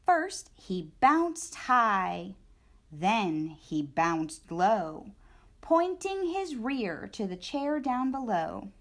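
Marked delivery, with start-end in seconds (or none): 0.0-5.1 storyteller bouncing the words; bouncy slow reading. A narrator's reading. Narration acting out bouncing.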